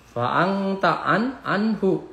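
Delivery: "anta" hummed long, "anhu" crisp; idhar recitation. Shown as a demonstration. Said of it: "dibaca jelas".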